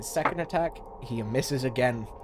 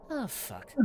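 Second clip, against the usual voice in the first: soft, higher-pitched swear; resigned curse; 7 semitones higher, 10 dB quieter than usual. Acted. Pirate watching lightning about to strike him, quietly resigned. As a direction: curse under your breath before impact. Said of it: "He goes".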